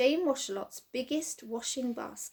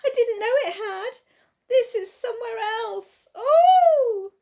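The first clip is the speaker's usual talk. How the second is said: panicked wail; 11 semitones higher, 10 dB louder than usual. A narrator's reading. Man panicking, land vanished.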